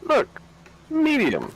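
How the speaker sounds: very high, thin squeal; squeaky high voice. Pretend. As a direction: pitch way up like a cartoon. Excited squeal at game crane.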